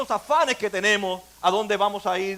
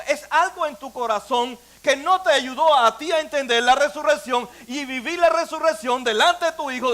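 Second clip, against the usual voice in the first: ordinary talk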